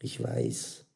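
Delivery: low, soft admission; brittle, calm. A narrator's reading. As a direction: answer quietly, with a touch of fragility. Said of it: "Karins Stimme war brüchig, ruhig".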